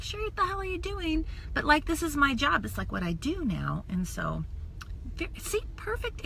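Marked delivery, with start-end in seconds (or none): 0.0-1.2 quick, higher, softer mocking jab; fast, hushed disapproving friend; mock judgmental friend. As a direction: lightly act out a scornful old classmate. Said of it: "high school friends ... are like".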